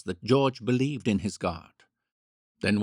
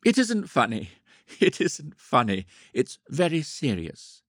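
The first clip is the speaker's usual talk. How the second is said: higher, louder, laugh breaking through; fighting back laughter; 5 semitones higher, 3 dB louder than usual. A narrator's reading. Woman scolding herself in her bedroom, struggling not to laugh. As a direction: insist on seriousness while trying not to laugh. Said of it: "determined effort not to laugh".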